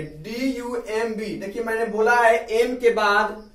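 usual speaking voice

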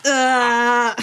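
loud playful "ah" to cap the build-up; sudden pretend scare cry; mock scream. Teasing a partner after the suspense theme, loud mock scream.

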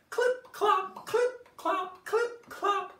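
high, loud clip-clop chant; clip-clop sounds. Acted. Storyteller making hoof sounds for kids, high and loud. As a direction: sing out the hoofbeats brightly. Hooves sound effect for children.

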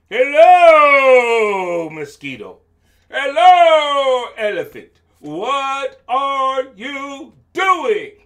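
very loud, stretched-out greetings; roaring lion voice. Acted. Lion greeting mosquito and elephant, bellowing. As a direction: bellow slowly as a big storybook lion.

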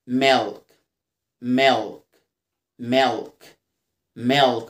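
"ميلك" as "melk", dark l, falling; dark L drill. Shown as a demonstration. Teacher drills milk for learners, "ميلك" with dark l. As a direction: repeat "ميلك" evenly with a lengthened dark l and pauses. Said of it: "بنطقها كده ل".

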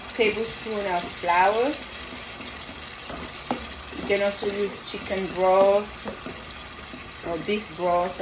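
normal talking voice

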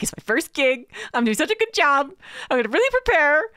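high, loud, keen rookie voice; mock eager newbie. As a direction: gush like a proud first-timer. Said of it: "and you're just like".

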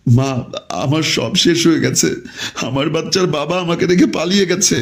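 mock-weeping distress; tearful daughter. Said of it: "কান্নাকাটি করা শুরু করে দিয়েছে".

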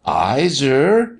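high, gently pleading. A narrator's reading. Martha looking fearlessly up at the doctor, pleading. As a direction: appeal to him with a lifted voice. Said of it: "she pleaded".

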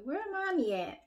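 put-on light high child voice; a hint of a small daughter's voice; imitating her daughter. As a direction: pipe it up like a little kid asking. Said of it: "You are like".

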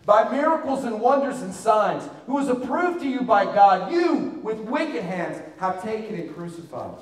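loud, high preaching of scripture; preaching at full force. Acted. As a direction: proclaim the verse with full fire. Preacher quoting Acts loudly.

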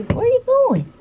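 very high coo. Real, not acted. Baby talk to grandson.

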